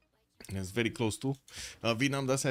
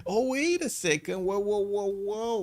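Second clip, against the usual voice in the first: excited; 8 semitones higher, 4 dB louder, slower than usual. Real, not acted. Sudden gold spike in game.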